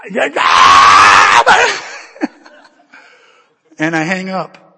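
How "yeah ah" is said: long, very loud frustrated roar; meltdown scream. Retold or imitated. Husband losing it before hanging up, frustrated scream. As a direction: let out a drawn-out tantrum scream.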